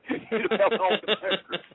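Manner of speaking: real laughter through the words, slow and high; words breaking up with laughter; laughing. Real, not acted. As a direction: giggle while delivering the punchline. Laughing at his own joke.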